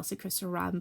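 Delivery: ordinary delivery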